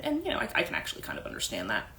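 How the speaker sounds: a whispered confession between friends; whispered aside. Real, not acted. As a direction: slip it in under your breath, fast. Quick whispered aside to listener.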